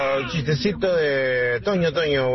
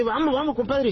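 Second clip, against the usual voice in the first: eager compadre; 11 semitones higher, faster than usual. Retold or imitated. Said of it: "Entonces le dice".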